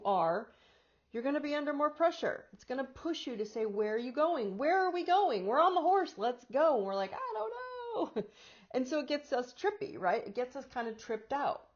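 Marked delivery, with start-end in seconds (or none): 3.7-6.8 higher, louder, hurried demands; urgent push. Acted. The pressure urging you to run, higher and insistent. 7.1-8.2 very high, slow, lost whimper; helpless little voice. Acted. Us answering the horse, high, slow and helpless. Bewildered reply to the pressure.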